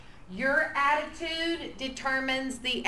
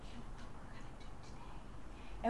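hushed whisper, much softer; 16 dB quieter than usual. Shown as a demonstration. Teacher whispering to pull the class in. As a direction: draw the kids in with a secret whisper. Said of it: "when I start whispering".

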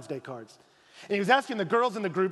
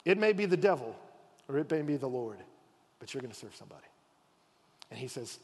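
ordinary talk

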